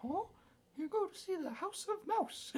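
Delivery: high squeaky cartoon mouse voice; Mickey Mouse voice. To her friend, recalling Disneyland, a high Mickey squeak. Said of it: "I can't do the Mickey voice now".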